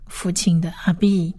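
ordinary talk

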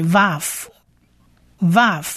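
vav said slowly as "vahf", closing on f; sounding out vav. Shown as a demonstration. Teacher shows the silent vowel points, slow vav demo. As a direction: model vav slowly, pause, repeat. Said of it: "它不发音".

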